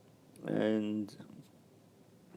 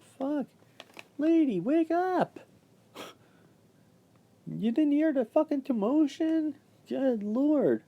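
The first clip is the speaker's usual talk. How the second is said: exasperated outburst. Real, not acted. Venting about the oblivious job coach, high and exasperated. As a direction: throw up your hands in disbelief. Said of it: "exasperated outburst".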